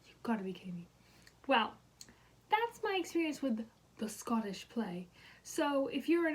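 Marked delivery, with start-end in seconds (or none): 0.1-0.9 whispered in disbelief, lower; disbelieving whisper. Retold or imitated. Reacting to being told about the Scottish play, whispered disbelief. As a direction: breathe it out in shock. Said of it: "I was like".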